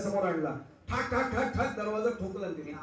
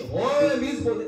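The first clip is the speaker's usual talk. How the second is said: defiant reply; 5 semitones higher, 7 dB louder, slower than usual. Acted. Husband snaps back at wife.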